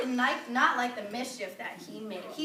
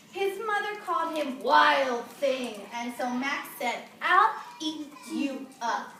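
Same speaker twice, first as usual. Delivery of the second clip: storybook reading; 4 dB louder, slower than usual. A narrator's reading. Reads story aloud.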